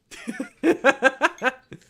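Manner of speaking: high, loud giggling burst; laugh. Real, not acted. Player wobbles the game's sign, laughing.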